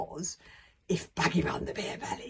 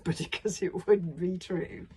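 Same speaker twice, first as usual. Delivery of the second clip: laughing; slower than usual. Real, not acted. Narrator laughs at Hiccup's failure.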